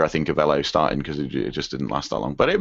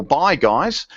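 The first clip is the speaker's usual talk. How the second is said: higher-pitched attempt at an Australian accent; Aussie accent; 9 semitones higher than usual. Podcast host reading a listener's farewell email, in an Aussie accent. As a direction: put on a light Aussie voice. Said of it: "read this in an Aussie accent".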